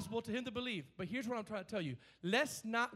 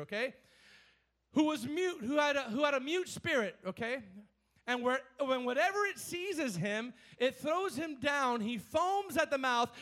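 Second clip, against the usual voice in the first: raised, driving preacher read; fervent scripture reading; 4 semitones higher, 5 dB louder than usual. Acted. Preacher reading Mark to the church, raised and fervent. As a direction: proclaim the verses to the congregation.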